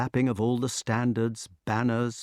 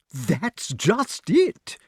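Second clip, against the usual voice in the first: high-pitched, drawn-out cry; high excitement; 8 semitones higher, 3 dB louder, much slower than usual. A narrator's reading. Pellinore pounces on the next-of-kin question, excited. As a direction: seize on it, stretching each word. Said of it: "cried King Pellinore in high excitement".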